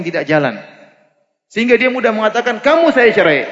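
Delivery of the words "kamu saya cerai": a hot-tempered husband snapping out the divorce, louder and higher; angry outburst. Retold or imitated. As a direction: fling the words out in a temper. Husband blurts divorce in anger. (suami membentak marah)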